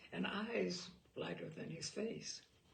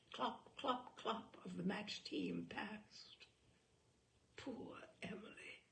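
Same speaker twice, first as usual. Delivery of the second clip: hushed whisper; 8 dB quieter, slower than usual. A narrator's reading. Hushed narration close.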